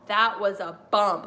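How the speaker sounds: loud, impressed whoa, slowed down; imitating a stepdad wowed by a bump; stepdad's loud whoa. Stepdad watching wrestling, a loud impressed whoa. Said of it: "my stepdad was like".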